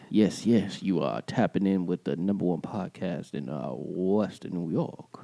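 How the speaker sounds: late-night radio announcer, put on. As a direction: croon it low into the mic. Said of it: "This is our radio voice".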